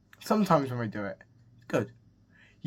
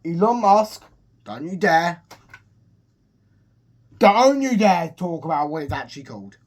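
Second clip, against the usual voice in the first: raised, higher-pitched mock scolding; mock warning; 4 semitones higher, 7 dB louder than usual. Fan telling Elon Musk off, mock indignant. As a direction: warn him off loudly in pretend outrage.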